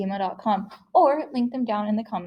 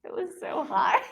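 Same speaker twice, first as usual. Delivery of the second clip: laughing; 12 semitones higher than usual. Real, not acted. Laughing at high boom.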